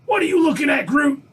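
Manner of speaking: high, loud barking drill sergeant shout. Pretend. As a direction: yell at the recruits like a drill instructor. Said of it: "I should go up and do the drill sergeant".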